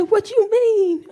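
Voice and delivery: high, slow puzzled son; drawn-out innocent question, voicing her son; son's voice. Mocking her son's innocent question, high and slow.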